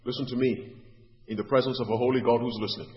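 ordinary talk

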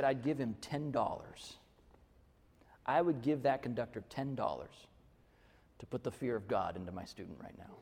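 low, hushed aside to a colleague, slowed down; quiet aside. Retold or imitated. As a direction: lean in and murmur it just for him. Leaned over to whisper aside.

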